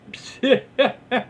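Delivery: high, airy laugh; real giggle. Real, not acted. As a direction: burst out laughing. Laughing at chat.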